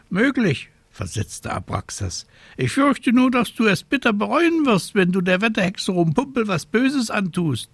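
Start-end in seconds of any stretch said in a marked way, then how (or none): 0.1-0.7 high, fussy scolding raven voice. A narrator's reading. Raven replies. 2.6-7.7 high, fussy scolding raven voice. A narrator's reading. Raven warns.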